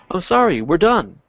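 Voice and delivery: ordinary delivery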